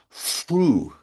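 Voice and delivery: through said as "froo"; child's mispronounced "through" with an F. Retold or imitated. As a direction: swap the TH of "through" for an F, drawn out. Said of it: "pronouncing the word through, T H, the in-, English T H, as through".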